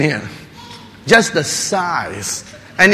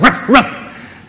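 higher, louder mock bark; playful imitation of dogs barking; 7 semitones higher, 6 dB louder than usual. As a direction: bark it out like a small dog. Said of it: "all kinds of dogs".

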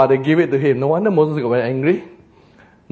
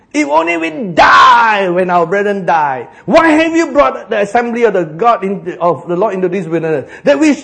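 angry complaining crowd; 6 semitones higher, 7 dB louder than usual. Acted. People complaining angrily to Moses.

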